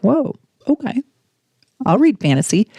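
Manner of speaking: high startled whoa, then a shrug; mock surprised whoa. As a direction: act taken aback, then go along. Mock surprise at friend's claim.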